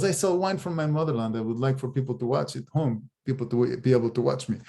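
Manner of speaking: a hint of a Russian immigrant voice; Russian accent. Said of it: "he's got the heavy Russian accent".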